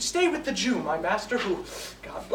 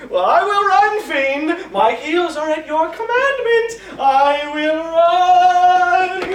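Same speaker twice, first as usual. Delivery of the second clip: high, loud cry, last word held long; exultant shout; 5 semitones higher, 8 dB louder, slower than usual. Acted. Lancelot giving in to the fiend's command, exultant shout. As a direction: build to a long bellowed final word. Resolves to flee, obeying the fiend.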